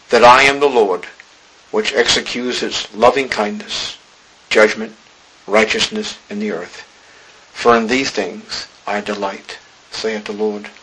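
measured, weighty reading of holy text; solemn scripture reading. Acted. Reads scripture aloud.

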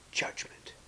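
Judgment whispered, unvoiced and soft. Shown as a demonstration.

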